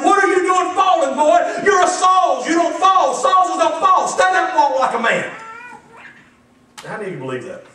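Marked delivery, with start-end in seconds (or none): 0.0-5.3 put-on high, loud furious yell at a child; angry father shouting. Father shaking his fallen son, shouting angrily. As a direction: bark at the boy to stand up. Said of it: "And he said".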